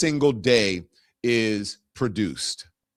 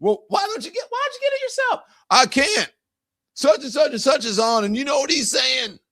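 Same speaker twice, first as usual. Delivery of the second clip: high mock Southern bicker; Southern accent, high and teasing; lampooning couch viewers in Southern accent; 14 semitones higher than usual. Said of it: "I sound like a Southerner".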